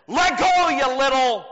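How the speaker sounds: loud, high angry bellow; dad finally yelling at his little son, loud booming bellow. Retold or imitated. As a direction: boom it out like a furious dad. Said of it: "in a booming voice".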